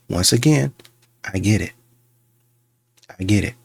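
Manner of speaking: usual speaking voice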